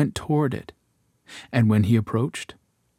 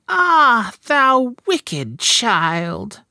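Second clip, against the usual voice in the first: high, slow furious witch voice; enchantress crying out; 12 semitones higher, 5 dB louder, much slower than usual. A narrator's reading. Enchantress finds out Rapunzel's secret, high slow furious cry. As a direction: shriek it high and drag it out in rage. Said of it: "cried the enchantress".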